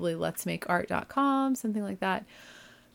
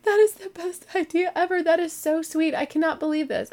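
gushing delight; 10 semitones higher than usual. Retold or imitated. Retelling her delighted reaction.

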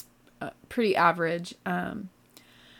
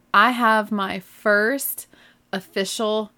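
ordinary talk

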